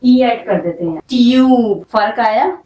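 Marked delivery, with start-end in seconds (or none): 1.0-1.8 ट्यूब said louder, with a long yoo vowel; teacher stretches and hits ट्यूब; long vowel tube. Shown as a demonstration. Teacher shows how silent e changes tub. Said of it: "यू का sound long हुआ".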